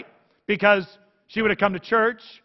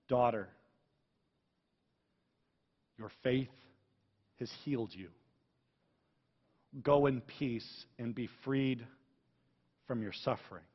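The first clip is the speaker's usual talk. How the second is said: tender blessing; 5 semitones lower, 9 dB quieter than usual. Acted. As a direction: bless her like a loving father. Jesus speaks to the healed woman.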